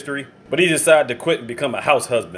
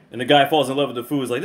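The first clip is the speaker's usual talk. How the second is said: normal talking voice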